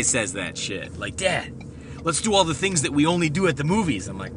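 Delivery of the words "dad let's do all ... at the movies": put-on high, loud kid's voice; imitated excited kid. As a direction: mimic a son bursting with plans. Mimics the son's excitement.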